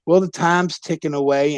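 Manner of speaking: ordinary delivery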